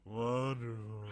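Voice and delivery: long, drawn-out WONDERFUL; exaggerated, lingering echo of a sarcastic word; second mock attempt. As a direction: stretch WONDERFUL with a held first syllable. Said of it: "let me try that again".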